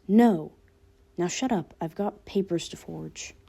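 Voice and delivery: ordinary delivery, nothing marked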